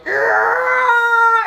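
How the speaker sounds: very high shouted animal scream in a put-on voice; mock animal scream. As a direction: let out a wild beast scream, imitating. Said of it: "screaming like some animal".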